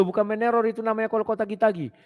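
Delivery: usual speaking voice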